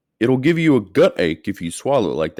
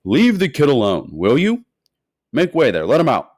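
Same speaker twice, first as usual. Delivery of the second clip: louder shopkeeper shooing the crowd; shout; 5 semitones higher, 7 dB louder than usual. A narrator's reading. Shopkeeper shouting the crowd away from Charlie, louder. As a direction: clear the way, firmly. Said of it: "the fat shopkeeper shouted".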